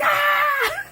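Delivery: sudden high startled yelp; mock fright shriek; startled scream. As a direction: scream like you just got jumped. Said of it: "I'd be like".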